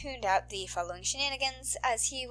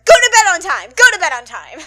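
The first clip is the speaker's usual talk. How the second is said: shouted, louder bossy order; loud reminder; 4 semitones higher, 15 dB louder than usual. Real, not acted. Podficcer ordering listeners to sleep, loud and bossy. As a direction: bark it at the listeners like a parent. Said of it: "there's one that I'm forgetting".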